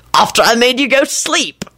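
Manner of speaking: unremorseful. A narrator's reading. Lupin explaining his trick to Neville, unremorseful. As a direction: toss it off proudly. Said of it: "Lupin replied unremorsefully".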